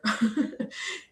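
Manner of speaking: breath-light laugh; soft chuckle. Real, not acted. Joking she'd look like a turtle, soft chuckle.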